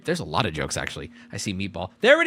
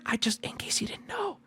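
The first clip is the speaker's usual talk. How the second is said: mock whisper, pitched up; imitated whisper; 13 semitones higher, 10 dB quieter than usual. Store employee whispering a discreet warning to the streamer, mock whisper. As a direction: whisper it like a helpful store clerk. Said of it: "the whispered".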